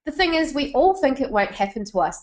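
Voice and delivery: ordinary delivery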